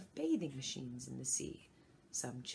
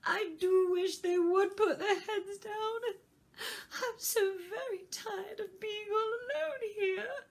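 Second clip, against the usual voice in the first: sudden crying wail, much higher and louder; a child bursting into tears, much higher; 9 dB louder than usual. A narrator's reading. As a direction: break into sobs on it. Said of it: "with a sudden burst of tears".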